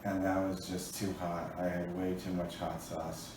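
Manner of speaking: weak, pained and quiet; barely getting words out. Real, not acted. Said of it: "it was the worst pain".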